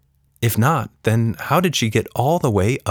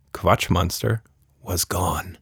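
usual speaking voice

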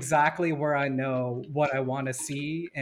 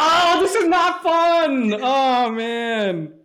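mock dismay; 7 semitones higher, 6 dB louder, much slower than usual. Real, not acted. Dramatic complaint about agreed answer.

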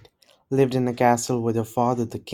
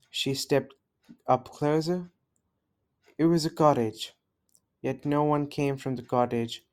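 plain reading voice

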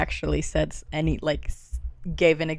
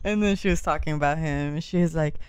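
ordinary delivery

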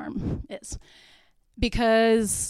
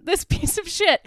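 high, loud, scornful disbelief; exasperated and appalled, voice up high; indignant outrage; 9 semitones higher, 8 dB louder, slower than usual. Real, not acted. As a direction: spit it out in disgust at him. Indignant rant about the father.

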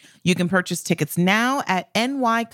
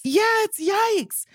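mock dismay; 14 semitones higher, slower than usual. Real, not acted. Echoing yikes in mock dismay.

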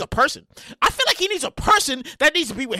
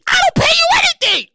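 high, loud incredulous yell; outraged shout; 7 dB louder than usual. Pretend. Host self shouting at writer alter ego. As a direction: shriek in disbelief. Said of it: "the two alter egos".

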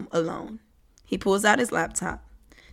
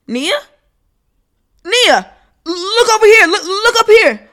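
much higher, loud calling out; shouting paparazzi; 12 semitones higher, 10 dB louder than usual. Acted. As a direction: yell for her attention.

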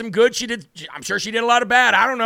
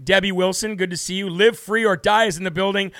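normal talking voice

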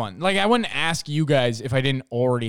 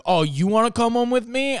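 high, loud mocking challenge; mock-outraged, pitch shooting up; mock fighting; 11 semitones higher, 6 dB louder than usual. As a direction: throw it back as a taunting imitation. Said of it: "they, like, started fighting".

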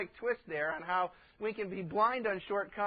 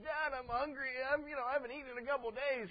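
put-on higher, fast child's plea; lightly voiced kid begging; hungry child; 5 semitones higher, 5 dB quieter, faster than usual. Preacher voicing a starving kid asking dad for food, pleading.

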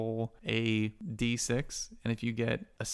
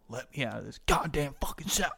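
strained, slowed-down angry demand; acted fury, forcing out the words; getting mad; 4 semitones higher than usual. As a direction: mad prisoner demanding release. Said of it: "he starts getting mad".

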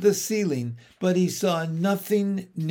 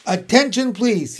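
raised, higher announcing voice; loud, firm; 6 semitones higher, 7 dB louder than usual. A narrator's reading. Pig addresses the whole barn, loud and firm. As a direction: call for attention loudly and firmly. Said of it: "he said in a loud, firm voice".

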